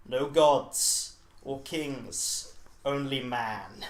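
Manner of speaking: very slow, weighty reading; solemn inscription. Acted. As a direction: intone it like carved words.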